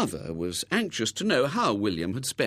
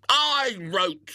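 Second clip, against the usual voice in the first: yell; 11 semitones higher, 5 dB louder than usual. Acted. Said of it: "he yelled".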